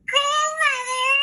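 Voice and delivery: put-on very high, slow falsetto girl voice; wolf squeaking like a little girl; hammed-up little girl voice; wolf faking a little girl. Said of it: "in his best little girl's voice".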